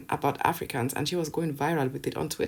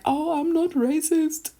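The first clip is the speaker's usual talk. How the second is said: high, slow, whiny put-on voice; mock the excuses in a drawn-out high whine; exaggerated defensive whine, far above own pitch; 11 semitones higher, 4 dB louder, slower than usual. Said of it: "you don't need to be like".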